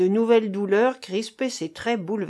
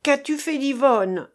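raised, urgent demand; crying out; 6 semitones higher, 5 dB louder than usual. A narrator's reading. Keinec demands news of Yvonne from the dying man, crying out. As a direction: cry out the question, pitched up. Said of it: "s'écria Keinec".

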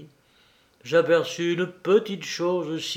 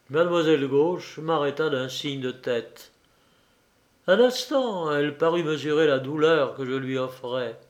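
plain reading voice

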